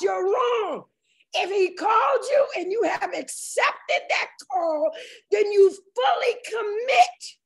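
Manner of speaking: fervent preacher driving the point home; impassioned preaching. Real, not acted. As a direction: preach it high and urgent, stretching key words. Preaches at full force.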